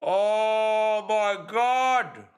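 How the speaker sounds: high, very drawn-out groan of disbelief; exasperated disbelief. Real, not acted. Fan reacting to a puzzling offside call, exasperated cry. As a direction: stretch every word out in disbelief.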